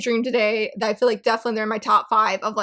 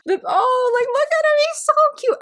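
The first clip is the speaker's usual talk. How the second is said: very high, louder, melting over cuteness; cute squeal; 3 dB louder than usual. Real, not acted. Fan showing viewers a panda drawing, squealing high. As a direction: squeal at the picture.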